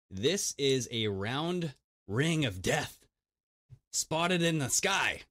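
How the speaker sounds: slow, drawn-out ominous announcement; portentous tabloid announcer, half speed; dramatic headline read. Acted. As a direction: stretch the headline out for mock drama.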